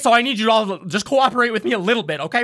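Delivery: high, hurried goblin warning; anxious little official shooing them out; hobgoblin voice. Acted. Hobgoblin insists the party leave now. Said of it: "And he goes".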